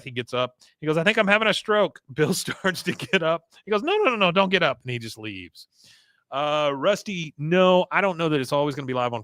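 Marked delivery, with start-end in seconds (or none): 1.0-2.0 mock panic. Con feigns collapse, retold. 3.8-4.8 hurried protest. Retold or imitated. Con stops Bill rising.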